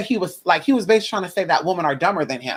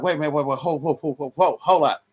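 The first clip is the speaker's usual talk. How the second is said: mild panic; 4 semitones lower, 4 dB quieter than usual. Retold or imitated. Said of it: "I'm panicking a little bit".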